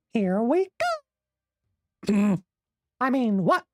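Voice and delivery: Mickey Mouse voice. Pretend. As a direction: keep holding the Mickey voice. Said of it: "we have to speak as Mickey Mouse now".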